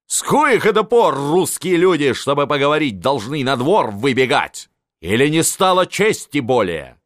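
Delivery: high indignant outburst; old field marshal thundering at his nephew; raging old marshal. A narrator's reading. (гневно, с надрывом)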